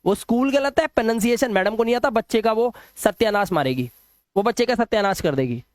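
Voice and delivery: ordinary delivery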